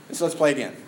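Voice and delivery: ordinary talk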